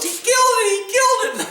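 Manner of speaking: high-pitched mock distraught wail; retold crying. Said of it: "And she's crying".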